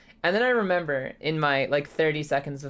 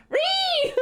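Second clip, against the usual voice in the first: squeal; 5 dB louder than usual. Acted. Squeals a greeting at viewer.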